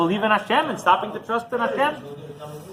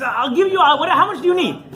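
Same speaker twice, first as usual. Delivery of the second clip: eager rich man; 9 semitones higher, 4 dB louder than usual. Retold or imitated.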